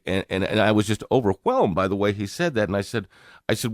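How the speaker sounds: usual speaking voice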